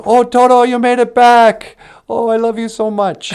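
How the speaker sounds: put-on high, loud, gushing little girl voice; overjoyed girl. Dorothy greets Toto coming home, high and gushing. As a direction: gush with mock delight at the dog. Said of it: "And she's so happy".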